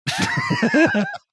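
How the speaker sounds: high burst of laughter; real laughter; loud laugh. Real, not acted. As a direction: laugh hard. Laughing at the story.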